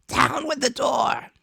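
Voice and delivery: high, slow, loud snarl of a blind beggar; blind beggar crying out. Acted. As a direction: bellow the order at the door. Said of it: "he cried".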